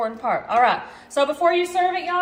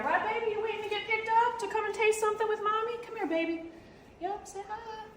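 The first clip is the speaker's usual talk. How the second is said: doting pet talk; 8 semitones higher, 7 dB quieter than usual. Real, not acted. Mom coos softly to her dog Kevin, high baby talk.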